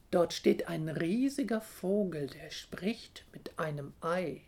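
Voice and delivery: usual speaking voice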